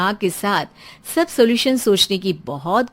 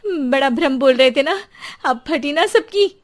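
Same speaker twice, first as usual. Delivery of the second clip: higher, louder told-you-so jab; taunting; 6 semitones higher, 4 dB louder than usual. Acted. Wife taunting neighbours who doubted her, gloating. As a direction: rub it in their faces.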